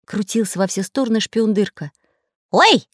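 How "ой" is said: sudden high loud yelp; cry out. Acted. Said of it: "вдруг закричал он".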